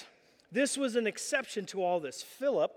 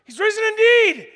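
loud, high-pitched triumphant shout; exuberant shout; 12 semitones higher, 14 dB louder than usual. Retold or imitated. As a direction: call it out across a crowded café. Imitates loud answer in Starbucks.

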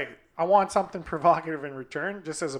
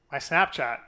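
ordinary talk, nothing marked

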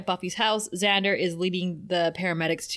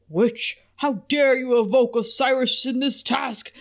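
booming demon head; loud, higher demon rebuke; 5 semitones higher, 3 dB louder than usual. Acted. Demon head speaks to Willow.

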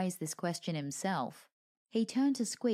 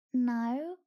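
muffled from inside a bag; 6 semitones higher, 6 dB louder than usual. A narrator's reading. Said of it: "came the muffled reply".

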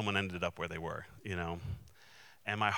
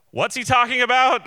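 much higher and louder, imitating her puzzled aside; quoting the woman; 14 dB louder than usual. Sweet woman asks her companion about the speaker, high loud imitation.

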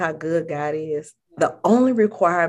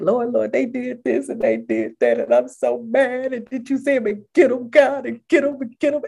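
higher, louder hammed-up angry pleading; mad prayer; 6 semitones higher, 3 dB louder than usual. Voicing someone praying while angry at others, mock fuming plea. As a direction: demand revenge from God, fuming. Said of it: "you mad and you're like".

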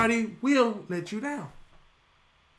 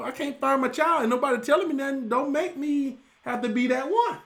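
higher and louder, getting worked up; worked-up parent; 7 semitones higher, 5 dB louder than usual. Real, not acted. Father telling the church about the missing bus: worked-up, higher and louder. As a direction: let the frustration rise. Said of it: "I was not very calm".

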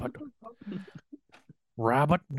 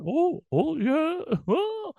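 gentle honey-loving bear voice; Winnie the Pooh voice; much slower than usual. Retold or imitated. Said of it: "round it out like an old man".

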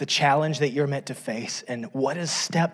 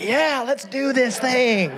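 much higher, slow gung-ho cheer; eager wife; 10 semitones higher, slower than usual. Retold or imitated. Wife urging husband to rock the boat, excited. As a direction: imitate the thrill-seeking wife. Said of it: "she'd be like".

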